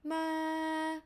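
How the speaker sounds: "ma" held high and level; high pitch. Shown as a demonstration. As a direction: pitch "ma" high and keep it there. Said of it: "your high pitch would be".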